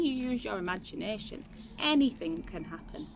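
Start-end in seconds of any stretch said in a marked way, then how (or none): none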